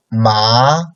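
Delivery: "ma" with a rising second tone. Shown as a demonstration. Teacher showing students the second tone, rising "ma". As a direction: lift "ma" upward like a question. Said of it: "second tone like upcoming tone".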